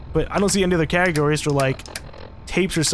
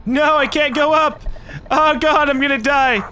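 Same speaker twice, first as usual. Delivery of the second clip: panicked cry; 8 semitones higher than usual. Real, not acted. Panicking while dying in game.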